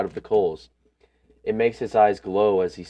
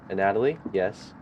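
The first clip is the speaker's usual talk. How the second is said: ordinary talk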